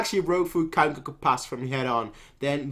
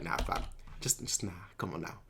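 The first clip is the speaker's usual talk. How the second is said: soft, lazy, smug brush-off; smug dismissal; 8 semitones lower, 14 dB quieter than usual. Retold or imitated. Kanki brushing off the enemy's strategy, soft smug dismissal. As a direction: wave them off under your breath. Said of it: "Kanki's like".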